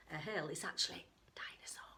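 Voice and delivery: fast secret whisper to listeners. Real, not acted. Reader whispering the surprise to children, hushed. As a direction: let them in on the secret, whispering.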